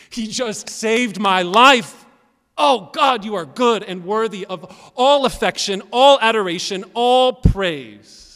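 high, impassioned outcry of praise; exultant full-voiced adoration to God; loud preacher's shout. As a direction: build in fervour, hammering each 'all'. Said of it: "loud preachers shout".

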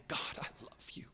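hushed, intimate whisper to God; very soft private devotion; whispered prayer. Shown as a demonstration. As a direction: breathe it softly, barely voiced.

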